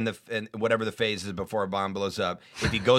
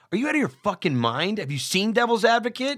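exasperated outburst; 10 semitones higher, 4 dB louder than usual. Retold or imitated. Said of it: "and I'm like".

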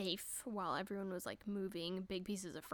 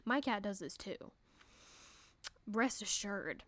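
ordinary talk, nothing marked

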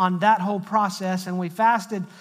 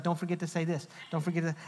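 quiet, quick aside imitating a wife; wife's reminder; 3 semitones lower, 9 dB quieter, faster than usual. Wife texting preacher mid-sermon, quiet mock reminder. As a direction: lightly mimic a nagging reminder, softly.

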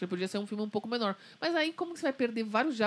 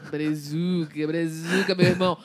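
put-on playful Rio accent, louder and hearty; like a carioca inviting friends for a beer; 2 semitones lower, 5 dB louder, slower than usual. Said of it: "eu fiz um sotaque carioca".

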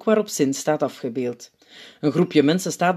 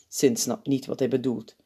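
usual speaking voice

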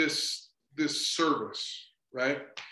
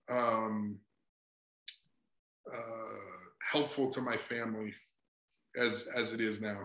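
real tears catching in the voice, lower and softer; choking up; 5 semitones lower, 7 dB quieter than usual. Real, not acted. Speaker tearing up telling the host about his family, softly choked. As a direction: hold back crying as the words fail.

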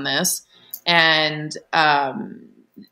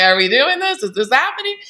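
higher-pitched incredulous questions; retold disbelief, pitched up; astonished questioning; 7 semitones higher than usual. Acts out her astonished reaction.

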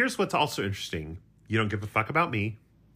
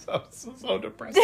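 laughing; 12 semitones higher than usual. Real, not acted. Laughs at friend's plan.

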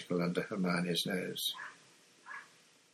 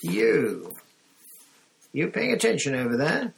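loud, high call across to pupils; raised, pitched-up voice that carries; carrying teacher's call; 6 semitones higher, 5 dB louder than usual. A narrator's reading. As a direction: call sharply to inattentive students. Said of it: "Professor Grubby Plank's voice carried over".

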